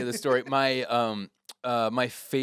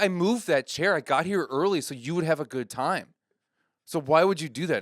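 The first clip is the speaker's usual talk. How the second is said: higher, louder mock reproach; upset, hurt; 6 semitones higher, 5 dB louder than usual. Comedian reproaching a heckler about the chair, imitated upset. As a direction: press the guilt trip, acting wounded. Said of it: "my friend gets upset".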